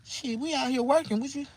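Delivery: high-pitched mock female reply; high imitated female voice; woman's voice.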